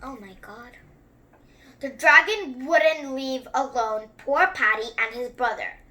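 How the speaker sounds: ordinary delivery, nothing marked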